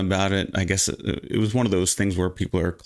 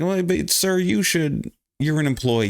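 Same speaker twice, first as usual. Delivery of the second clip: scolding the clerk; 7 semitones higher than usual. Retold or imitated.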